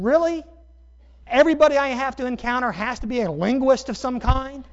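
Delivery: high, loud, incredulous outburst; exasperated disbelief. Retold or imitated. Preacher thinking everybody is a linguist, comically exasperated. Retold exasperated reaction to accent remarks.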